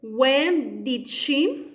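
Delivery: each word drawn out very slowly, What time did she; slow dictation. Shown as a demonstration. Teacher dictating to students while writing, very slow. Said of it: "puesto de repente when".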